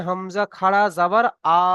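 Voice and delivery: "আ" stretched into a long, steady vowel at the end; drawn-out vowel. Shown as a demonstration. Teacher recaps the pronunciation for students, final "আ" drawn out. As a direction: spell it out, then hold the final "আ" long. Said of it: "খাড়া জাবারের উচ্চারণ হবে".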